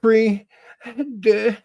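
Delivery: very high-pitched mock countdown; high, drawn-out comic farewell; playful German sign-off. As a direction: squeal out a long, high German goodbye.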